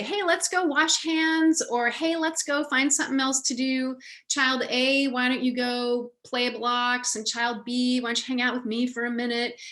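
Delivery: cool and easy. Shown as a demonstration. Adult casually splitting up two children at play, cool and easy. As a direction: keep it casual, as if nothing is wrong. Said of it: "so cool and easy".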